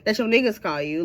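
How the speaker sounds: usual speaking voice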